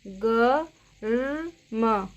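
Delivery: गर्म stretched very slowly, each sound drawn out; slow spelled-out word. Shown as a demonstration. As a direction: drag गर्म out syllable by syllable. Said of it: "इसकी आवाज़ रेफ की कैसे आती है". (गर्म को बहुत धीरे खींचकर बोलना)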